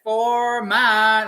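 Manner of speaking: high and loud, starting to fall; high sung peak; peak then coming down. Shown as a demonstration. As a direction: sing it at the top of the phrase then come down. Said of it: "then we come down".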